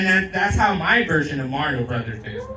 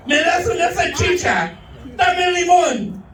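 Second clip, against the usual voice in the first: Raven voice; 11 semitones higher, 5 dB louder than usual. Retold or imitated. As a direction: beg shrilly for lemon. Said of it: "He's like".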